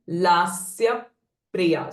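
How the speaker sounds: "lasya priya" slowly, lips pressed before pr; pressing effect. Shown as a demonstration. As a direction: hold a closure between "lasya" and "priya". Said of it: "it's a pressing effect while pronouncing". ("lasya priya" with the p pressed before release)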